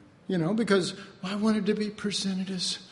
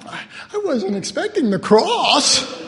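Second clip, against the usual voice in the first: mock surprise; 5 semitones higher, 5 dB louder than usual.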